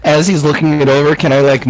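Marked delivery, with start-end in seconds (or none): none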